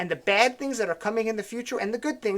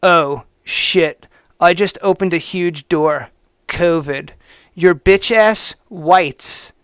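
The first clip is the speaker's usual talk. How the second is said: lower, louder, slow mocking read of the hater; mock hater's voice; 3 semitones lower, 6 dB louder than usual. Host reading a hater's post aloud, lower and louder in mocking voice. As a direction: sneer it out as the smug writer. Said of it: "Trying to do this in his voice".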